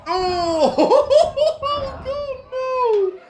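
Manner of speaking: very high, loud laughing oh God no; laughing shriek. Real, not acted. Commentator watching the ultimate knob attack, shrieking with laughter. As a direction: shriek with laughter in delighted disbelief.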